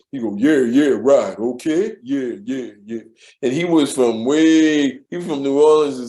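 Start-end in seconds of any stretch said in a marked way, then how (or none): 0.3-3.0 low, deep impression of an old-school coworker; deep-voiced old-school guy. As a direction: imitate a deep-voiced older man. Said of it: "he had a deep voice".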